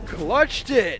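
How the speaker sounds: high, loud hype yell; excited shout. Real, not acted. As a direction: shout it in disbelief. Round won in clutch.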